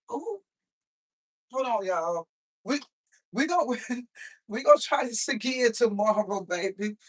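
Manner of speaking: ordinary talk, nothing marked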